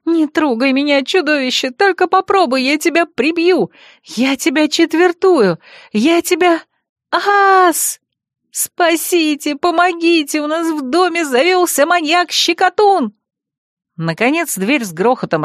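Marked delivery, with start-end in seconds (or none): 0.0-6.6 high, panicky threats; rising squeal of desperate warning; howling threats. A narrator's reading. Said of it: "взвыла я уже всерьёз". 7.1-13.1 high hammed-up panic; cry for help. A narrator's reading. Cornered heroine shrieks for help.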